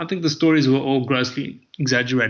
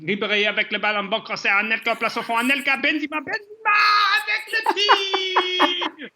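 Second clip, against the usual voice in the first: commentator climbing to the shot; French commentary building to a stretched goal call; French soccer commentary. Shown as a demonstration. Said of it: "There was France".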